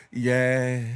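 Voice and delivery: deep lazy fake-scared voice; mock nonchalant. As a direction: play it cool and unbothered.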